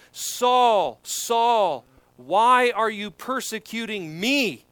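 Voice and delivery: solemn calling out. Retold or imitated. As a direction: call out slowly as the Lord confronting someone. Voicing the Lord's call to Saul.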